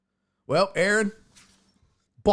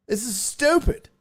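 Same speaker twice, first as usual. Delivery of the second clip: ordinary talk, nothing marked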